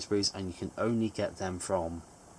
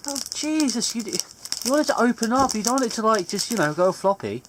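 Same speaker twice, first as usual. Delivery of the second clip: high, fretful complaining; high-pitched fussing, easing off at the end; exasperated; 13 semitones higher, 6 dB louder than usual. Real, not acted. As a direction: plead impatiently in a raised pitch. Impatient at slow card opening.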